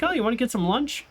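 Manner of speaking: a light impression of his own cheerful invite; mock cheerful self. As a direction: play up how eager you sounded. Retelling own offer to brother.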